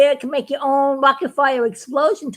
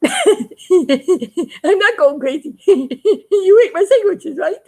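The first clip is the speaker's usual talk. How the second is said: higher, very slow, giggling through the words; delighted he isn't crazy, laughing it out; laughing relief; 6 semitones higher, 3 dB louder, much slower than usual. Retold or imitated. Astronaut realises the alien ate his sandwiches, slow giggling.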